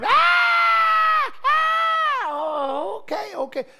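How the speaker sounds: very high loud crying scream, settling; hungry baby wailing then settling down; baby screaming for food. Retold or imitated. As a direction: scream then calm as fed.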